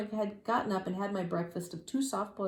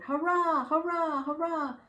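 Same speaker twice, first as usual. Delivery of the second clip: crowd cheering; 10 semitones higher, 3 dB louder, slower than usual. A narrator's reading. Audience cheering the shots, high hurrahs. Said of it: "the people went".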